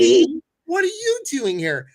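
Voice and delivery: astonished, high, loud welcome; delighted surprise. Real, not acted. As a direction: play it like a thrilled surprise.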